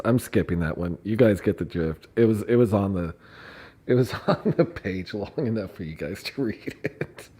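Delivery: normal talking voice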